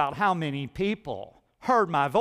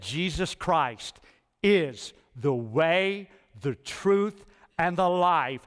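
slow emphatic creed; slower than usual. Shown as a demonstration. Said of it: "I'm at peace with that statement".

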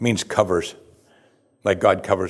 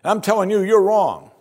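mock haughty rant, much higher and louder; arrogant apostle; 10 semitones higher, 3 dB louder than usual. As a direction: ham up a proud, pompous apostle scolding. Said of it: "He didn't do it like".

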